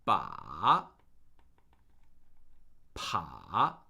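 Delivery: "Ba" and "pa" dipping very low, then rising; third tone. Shown as a demonstration. Teacher drilling the third tone, low dip and rise on "Ba, pa". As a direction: drop each "Ba, pa" to the bottom and swing up. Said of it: "that is the third tone".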